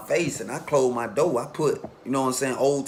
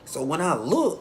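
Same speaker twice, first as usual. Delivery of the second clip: usual speaking voice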